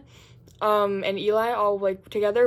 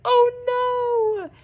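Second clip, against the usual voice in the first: mock dismay; 7 dB louder than usual. Friend's sympathetic reaction quoted.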